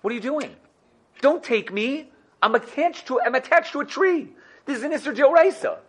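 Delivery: high-pitched protest from a talking apple; playfully indignant, pitch climbing as it objects; comic high-voiced telling-off, not a full shout; apple's mock yell. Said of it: "the apple yells at you".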